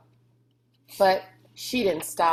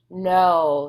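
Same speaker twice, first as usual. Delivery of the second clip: firm no; 7 dB louder than usual. A narrator's reading. Rob refusing firmly.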